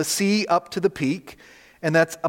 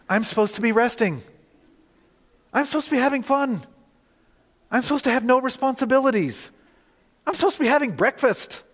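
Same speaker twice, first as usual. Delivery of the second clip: rising, higher complaining self-talk; exasperated inner voice; 6 semitones higher than usual. Retold or imitated. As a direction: let the frustration climb line by line. Inner complaint about his sabbatical.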